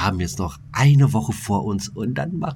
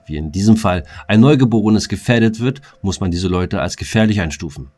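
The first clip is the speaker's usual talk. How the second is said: news read; 3 semitones lower than usual. Acted. Mock news report, quoted statement.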